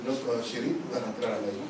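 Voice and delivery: quiet aside to wife. Acted. Man quietly tells wife leave.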